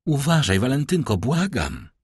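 pleading. A narrator's reading. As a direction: implore her to take care. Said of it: "składając ręce".

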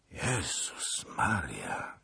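moaning. A narrator's reading. Zagloba hears of the disaster, dismayed moan. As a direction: groan it out, long and dismayed. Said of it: "jęknął pan Zagloba".

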